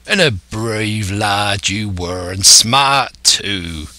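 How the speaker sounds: slow, rolling pirate praise; old pirate, approving. Acted. Silver praising his new recruit, approving old pirate. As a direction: stretch the vowels like an old sea-dog.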